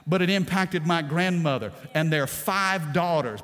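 fervent pulpit preaching. Acted. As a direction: preach it with rising fire. Preaching the grandfather's conversion.

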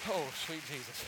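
breathless after the jump; out of breath. Real, not acted. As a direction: gasp it out, winded. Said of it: "I'm out of breath".

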